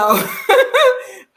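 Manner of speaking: loud high laugh bursting out; burst of high laughter. Real, not acted. Laughing out loud at the nosy neighbours.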